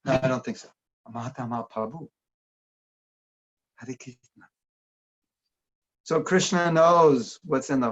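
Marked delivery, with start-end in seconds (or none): 1.1-4.5 quieter mimicked Chinese accent, "Prabhu" as "Pabu"; Chinese-accented "Mahatma Prabhu". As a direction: softly imitate Chinese devotees saying "Krishna" without its r. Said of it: "when I go to China".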